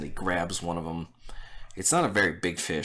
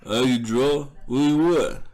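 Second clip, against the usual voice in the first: loud, higher, curt broken English; brusque Tomkin, louder and higher than the narration; gruff artist; 4 semitones higher, 6 dB louder than usual. Acted. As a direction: bark it out as a rough drawer.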